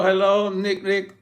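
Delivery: usual speaking voice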